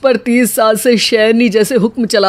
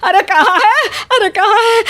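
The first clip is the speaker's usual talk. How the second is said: panicked search; 8 semitones higher than usual. Acted.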